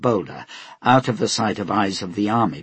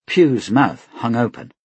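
usual speaking voice

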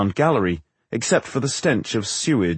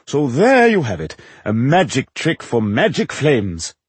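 conclude like a stage magician; triumphant showman actor; 3 semitones higher, 3 dB louder than usual. Acted. Actor concludes his demonstration.

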